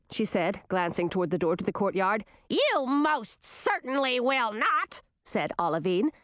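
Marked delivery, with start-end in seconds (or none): 2.5-5.0 high, sneering queen voice; scornful old queen. A narrator's reading. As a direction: sneer at your returning daughter.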